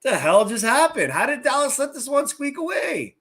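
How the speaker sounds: mock outrage at the result, high and loud; stunned disbelief. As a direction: replay the moment you saw the score, incredulous. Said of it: "And I'm like".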